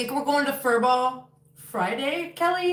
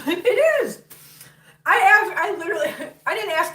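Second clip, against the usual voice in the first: high, loud burst of laughter; laughing; 7 semitones higher, 6 dB louder than usual. Real, not acted. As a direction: laugh through the words. Laughing at her own question.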